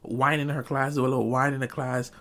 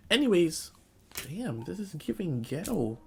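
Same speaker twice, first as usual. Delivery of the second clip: ordinary talk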